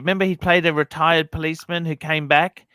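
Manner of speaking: usual speaking voice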